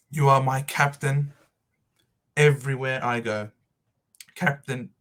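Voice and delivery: usual speaking voice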